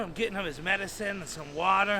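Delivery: ordinary delivery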